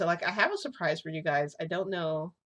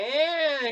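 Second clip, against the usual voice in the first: put-on toddler whine; 10 semitones higher, 6 dB louder than usual. Toddler whining, clinging to Endeavor.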